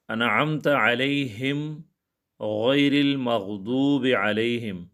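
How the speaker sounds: very slow, drawn-out melodic recitation; Quran recitation. Acted. Teacher recites the Fatiha for his students, slow Quranic chant. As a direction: chant each word slowly like in prayer.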